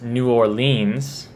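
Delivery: "New Orleans" as "new or-LEENZ"; northern pronunciation. Shown as a demonstration. Mimicking northerners, "New Orleans" ending in "LEENZ". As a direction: say "New Orleans" slowly with a long "leens". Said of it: "if you're from the north".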